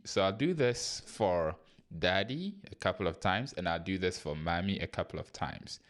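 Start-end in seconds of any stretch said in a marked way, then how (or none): none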